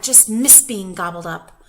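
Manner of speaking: plain reading voice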